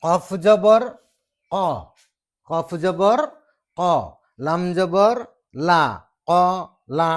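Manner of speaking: কফ জবৰ ক, লাম জবৰ লা, loud and drawn out with gaps; Arabic spelling recitation. Shown as a demonstration. Teacher spelling an Arabic syllable example, loud deliberate recitation. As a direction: recite letter and vowel, then blend into ক লা. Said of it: "এইটো এটা উচ্চাৰণ হ'ল".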